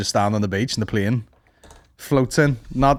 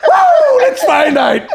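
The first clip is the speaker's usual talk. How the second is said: high, loud laughing woo; excited whoop; 7 dB louder, much slower than usual. Real, not acted. Cheering at the porn joke, loud and laughing.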